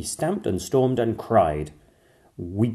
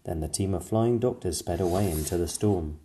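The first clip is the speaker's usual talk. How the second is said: plain reading voice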